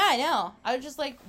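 ordinary talk, nothing marked

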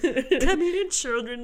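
slow squeaky lost voice; squeaky non-voice. Retold or imitated. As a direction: croak it out slowly. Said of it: "with my non-voice".

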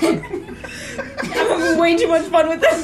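high, slow, laughing hard; cracking up while speaking. Real, not acted. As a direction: talk helplessly through giggles. Cracking up at own accent.

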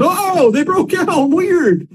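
high, slow mock surprise; mock-baffled shrug of a line; sarcastic deadpan. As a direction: play dumb, drawn out and high. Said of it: "you're like".

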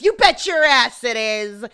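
loud, drawn-out imitated yell; hammed-up bad-cop shout; screaming cop. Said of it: "he just screams at him".

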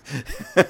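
short chuckle; amused chuckle. Real, not acted. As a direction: chuckle at your own skit. Laughing at his fox skit.

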